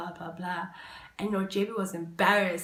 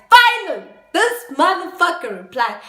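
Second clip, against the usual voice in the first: high loud outburst, finally!; exasperated shout; 9 semitones higher, 13 dB louder than usual. Real, not acted. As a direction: blurt it out fed up. Exasperated outburst at his reply.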